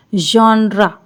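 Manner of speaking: जॉनरा with open "aa", "jaanra"; correct pronunciation. Shown as a demonstration. Teacher modelling जॉनरा for the class. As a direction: say जॉनरा with a broad first vowel. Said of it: "क्या पढ़ेंगे?".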